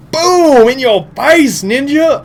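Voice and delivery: high, loud victory yell; explosive, high-pitched taunting; gloating shout. Pretend. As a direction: shout it high in playful triumph. Gloats over a kill.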